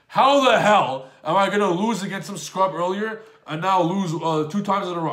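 loud, high retold outburst of outrage; furious. Player reliving two losses in a row, furious. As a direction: raise the voice in indignant disbelief, acting out past fury. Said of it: "I was furious. I was like".

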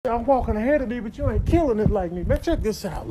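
ordinary delivery

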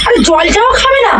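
can't believe they won't even drink; exasperated disbelief. Real, not acted.

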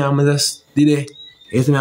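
normal talking voice

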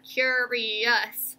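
"Curious" drawn out, each syllable clear; three syllables. Shown as a demonstration. Teacher showing what she hears, "Curious" slowly by syllable. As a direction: sound out "Curious" in three beats. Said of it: "I can hear three syllables in that".

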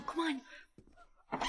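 ordinary talk, nothing marked

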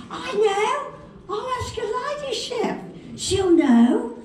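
high, squeaky London twit; London accent. Retold or imitated. Twit having an idea, high London accent. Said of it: "two twits what are sitting".

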